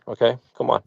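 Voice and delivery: ordinary delivery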